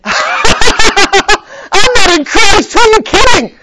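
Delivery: high, loud, slow laughing scoff; laughing defiance. Acted. Believer laughing back at the demons, loud and high. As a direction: laugh off the accusation. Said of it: "we just gotta say".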